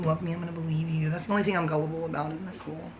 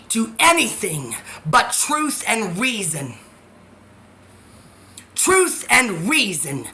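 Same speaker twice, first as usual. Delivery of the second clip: louder, dragged out word by word; slow emphatic declaration; 5 dB louder, slower than usual. Real, not acted. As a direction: hammer every word slowly. Slow emphatic preaching about Lucifer.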